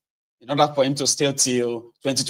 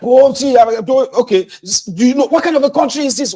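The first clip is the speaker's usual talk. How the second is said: much higher, loud and flustered rant; angry trader; 12 semitones higher, 8 dB louder than usual. Acted. A cheated trader yelling at the interviewer, furious. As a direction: sputter it out in fury. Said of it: "I said".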